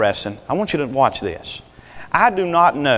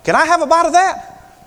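much higher, sweet mock husband; hammed-up cheerful husband asking for a bite; Adam sweetly asking Eve for a bite, high and hammed-up; 3 dB louder than usual. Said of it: "Adam walked up on Eve and said".

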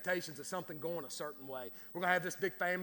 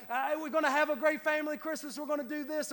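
high-pitched, mock excited planning; eager expectation; 9 semitones higher, 6 dB louder than usual. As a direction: gush about the big plans, pitched up. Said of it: "you get this expectation".

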